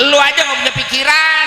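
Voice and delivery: high, loud indignant retort; sudden loud, high outburst of scolding; scolding shout. Acted. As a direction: shout it back at the complainer. Mock scolding of stingy complainers.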